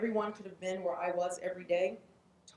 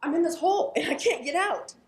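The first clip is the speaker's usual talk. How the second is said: much higher and louder calling out; man in a hole shouting up to his friend Joe, frantic; frantic plea to friend; 10 semitones higher, 10 dB louder than usual. Acted. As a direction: yell up to your buddy Joe. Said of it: "and he's like".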